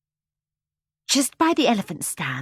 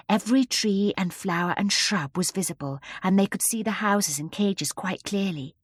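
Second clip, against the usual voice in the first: ordinary delivery, nothing marked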